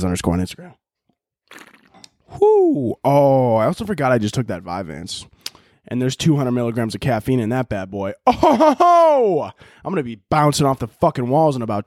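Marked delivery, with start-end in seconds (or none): 2.4-3.0 high, loud whoop; excited whoop. Real, not acted. Reacting to his stimulant intake. 8.3-9.5 long high, loud laughing "oh"; excited whoop. Real, not acted. Realises how wired he will be.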